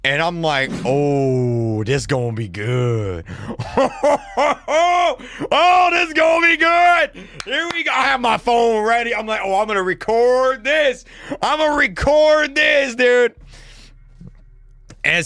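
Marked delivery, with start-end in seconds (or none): 0.8-3.2 low, drawn-out savoring of the drama; gleeful anticipation. Acted. Relishing the coming fight. 3.3-5.5 high, giddy chuckle; hammed-up excited laugh; gleeful laugh. Gleeful at coming drama. 5.5-7.1 high, loud giddy shout; gleeful excitement. Acted. Gleeful at coming drama. 7.4-13.4 high, giddy hype; gleeful excitement. Acted. Excited to record the drama.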